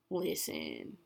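normal talking voice

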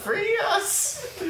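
very high, tiny squeaky plea; talking moss. Pretend. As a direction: squeal it like tiny creatures begging. Speaks as the haunted moss.

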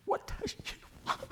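hushed whisper. Retold or imitated. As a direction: whisper so nobody wakes. Whispering at night by baby.

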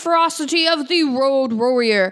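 lightly voiced vintage radio host; old-timey radio announcer. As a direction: read it like a 1940s radio announcer. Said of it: "make him like an old-timey radio announcer".

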